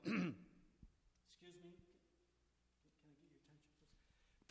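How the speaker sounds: barely voiced, near-whispered polite ask; timid polite request. Shown as a demonstration. Preacher showing how Jesus did not call out, meek near-whisper. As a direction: ask for attention meekly, almost inaudible. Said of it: "That is not how Jesus did it".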